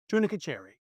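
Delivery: "Tunica cherry" as tuna-kuh cherry; "cherry" like the fruit, for Chachere; mispronounced name. Retold or imitated. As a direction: repeat the botched "Tunica cherry" in plain English sounds. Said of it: "That's how badly they mispronounced it".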